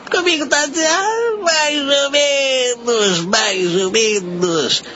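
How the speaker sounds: whiny high drawl. Acted. Says things are so-so.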